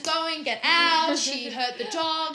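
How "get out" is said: loud, high mock yell of get out; mock angry shout. To her friend, playing the gut, a loud mock shout. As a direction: bark it like a furious flatmate. Voicing gut trashing house.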